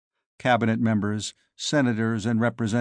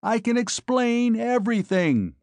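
much higher, shouted over gunfire; shouting; 11 semitones higher than usual. A narrator's reading. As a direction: yell explain over the noise. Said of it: "I roared in her ear".

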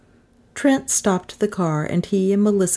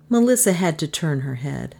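ordinary delivery, nothing marked

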